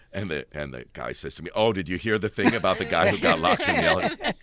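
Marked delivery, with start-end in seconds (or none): 1.6-4.3 eager and louder, leaning in with the news; excited gossip. Retold or imitated. Stranger in the elevator telling Patrick the rumour, eager and loud. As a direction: play the stranger bursting to share a juicy story.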